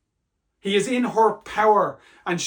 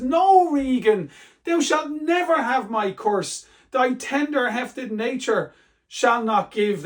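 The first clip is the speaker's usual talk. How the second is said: higher, slow, earnest plea; pleading Lear; 6 semitones higher, 3 dB louder, slower than usual. Acted. Lear to Regan, desperately flattering her so she will not turn on him, high and slow. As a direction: coax her with your tenderness, slowly.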